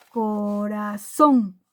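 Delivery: Corazón drawn out, weight landing on the final zón; teacher sounding out Corazón with zón stressed; stress on final syllable. Shown as a demonstration. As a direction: stretch Corazón slowly, leaning on zón. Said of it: "lleva acento o tilde".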